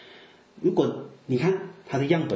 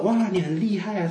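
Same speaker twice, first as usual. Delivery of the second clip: higher-pitched mock amazement; mocking admiration; 8 semitones higher than usual. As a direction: feign being impressed to poke fun. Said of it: "他们真的是在嘲笑他".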